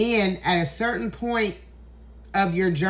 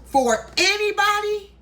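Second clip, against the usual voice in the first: "for anybody" pitched far higher and louder, almost cracking; emotional cry; 11 semitones higher, 3 dB louder than usual. Real, not acted. Repeating her vow to followers, voice rising into a near cry. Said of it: "this is the reason why I'm getting so emotional".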